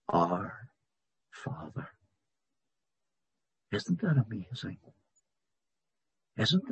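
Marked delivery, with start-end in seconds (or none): 0.1-1.9 hushed prayer. Acted. Preacher voicing the believer's prayer in a soft whisper. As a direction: drop to a near whisper on Our Father.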